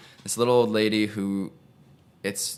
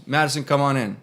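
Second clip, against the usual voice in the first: higher and louder call across the waiting room; 6 semitones higher, 4 dB louder than usual. Retold or imitated. Nurse calling the patient in from the desk, loud call.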